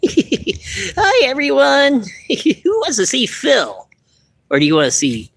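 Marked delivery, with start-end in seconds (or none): 0.1-3.9 much higher, laughing puppet greeting; high, slow puppet hello. Acted. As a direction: bounce up high as the eager puppet. Puppet Phil greets viewers.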